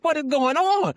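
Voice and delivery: high, loud "what is going on?"; alarmed shout from the kitchen; panicked yell. Retold or imitated. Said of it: "I'm like".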